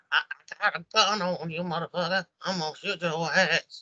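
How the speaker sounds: singer impression. Imagined singer threatens the lover, high put-on voice. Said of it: "can you imagine that?".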